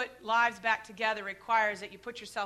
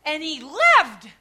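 triumphant reveal; 2 semitones higher, 13 dB louder, much slower than usual. Retold or imitated. Dramatic payoff of the dream.

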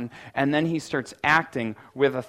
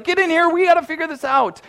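high, loud frantic summons; hammed-up boss calling an emergency meeting; mock panicked God; 13 semitones higher, 8 dB louder, faster than usual. God calling the Trinity to a panic meeting, a hammed-up loud shout. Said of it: "he calls a panic meeting of the Trinity".

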